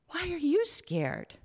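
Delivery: put-on a worried child asking gently; small child voice.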